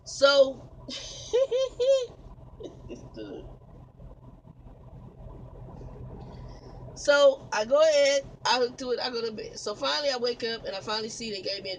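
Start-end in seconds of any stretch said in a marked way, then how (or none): 0.7-6.9 high laugh. Real, not acted. Laughing in disbelief.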